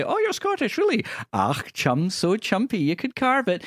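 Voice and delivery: high-pitched put-on Scottish accent; mock Scottish accent. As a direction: mimic strangers doing a bad, high Scottish accent. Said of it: "in a Scottish accent".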